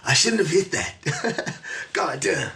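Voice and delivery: slow, high words through laughter; laughing regret. Acted. Host jokes about having hit that, laughing through mock regret. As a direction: crack up while playing regret.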